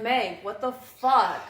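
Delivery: slow, drawn-out disbelieving Meg what the; mock shocked friend. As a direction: friends catching her naked downtown, slow stunned disbelief. Friends reacting to naked weirdness.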